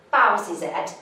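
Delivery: ordinary delivery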